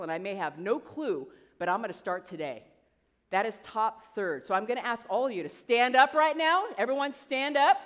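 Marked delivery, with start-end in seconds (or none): 4.5-7.8 loud, raised pitch, building to a call; rallying command. Real, not acted. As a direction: get the whole room on its feet. Telling whole audience to stand.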